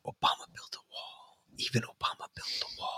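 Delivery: hushed, voiceless aside; mock whisper. Host addressing Obama supporters, mock confidential whisper. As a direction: whisper it like a secret.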